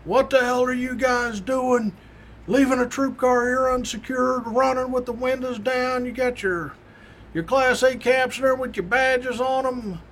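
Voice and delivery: imitating a boss going off; mock tirade at the troopers; sergeant chewing out. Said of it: "He is going off like a bomb".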